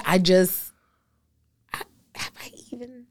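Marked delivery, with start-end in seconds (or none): 1.7-3.1 embarrassed whisper. Real, not acted. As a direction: breathe it out, barely voiced. Embarrassed before confessing.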